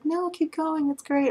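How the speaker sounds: high, airy reply from the donor; light and breezy, brushing off concern. Retold or imitated. Donor tells the feeder to keep going, in a high breezy voice. Donor reassuring during feeding.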